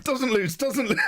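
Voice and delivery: high-pitched, laughing impression; Mister Oli. Streamer mimics Mister Oli in a laughing retold exchange, high mock voice. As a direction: imitate him in a high voice, cracking up. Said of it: "And then Mister Oli's like".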